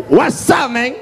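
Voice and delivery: high, punched English greeting; big gringo waiter. Acted. Huge gringo waiter confronting the customer, high challenging greeting.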